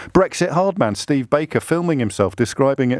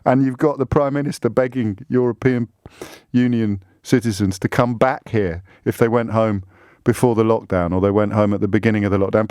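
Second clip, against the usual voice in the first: ordinary talk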